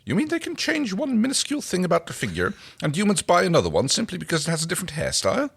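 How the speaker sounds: high, disbelieving question; can't believe humans would buy that; incredulous. A narrator's reading. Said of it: "stared at him incredulously".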